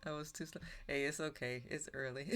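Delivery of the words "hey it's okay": ordinary talk, nothing marked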